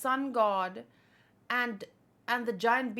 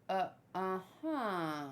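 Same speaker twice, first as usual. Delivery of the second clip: ominous uh-huh; 4 semitones lower, 6 dB quieter, slower than usual. Retold or imitated. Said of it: "don't say it like".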